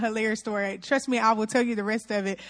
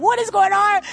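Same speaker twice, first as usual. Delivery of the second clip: stunned; 8 semitones higher, 6 dB louder than usual. Retold or imitated. Narrator watching the bike get thrown, loud high shock. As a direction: gasp it out in shock. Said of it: "And I'm like".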